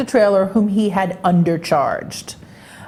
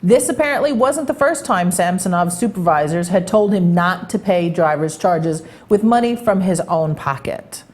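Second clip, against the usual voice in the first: usual speaking voice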